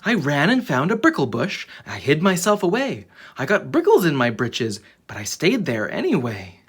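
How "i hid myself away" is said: ordinary delivery, nothing marked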